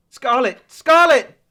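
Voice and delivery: very loud, high screamed name; desperate scream. Acted. Man screaming for girlfriend Scarlett, desperate scream. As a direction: scream her name in panic.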